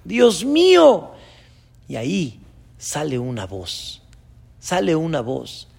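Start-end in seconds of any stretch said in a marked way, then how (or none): 0.0-1.1 high, loud, drawn-out plea; acted shout, stretching each plea; desperate cry. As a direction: cry out to God from the mountainside. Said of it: "En eso le grita a Dios".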